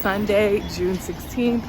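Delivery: usual speaking voice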